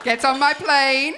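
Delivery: lightly voiced smooth conman; Tinder Swindler impression. Comic casts the boyfriend as a swindler, slow mock charm. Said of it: "a touch of this Tinder swindler".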